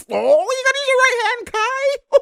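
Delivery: squeaky high voice calling out advice; high mock coaching. Commentator teases Kai in a squeaky high mock coach voice. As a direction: pipe it up like a fussy coach teasing.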